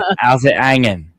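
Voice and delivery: mock Aussie accent. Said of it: "It's a good Aussie accent".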